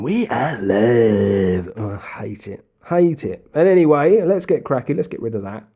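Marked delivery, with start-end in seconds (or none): 0.0-1.7 slow, drawn-out mock commentator; lightly voiced commentator, stretched out; a hint of a TV commentator going live; terrible Tildesley impression. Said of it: "my terrible, um, impression of, uh, Tildesley".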